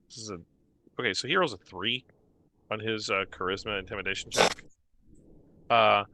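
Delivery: ordinary talk, nothing marked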